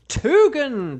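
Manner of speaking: game show reveal, high and loud; excited announcement. Real, not acted. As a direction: announce it like a prize winner. Announcing random pick winner.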